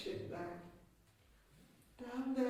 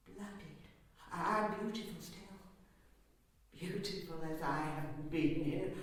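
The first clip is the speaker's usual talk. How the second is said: ordinary delivery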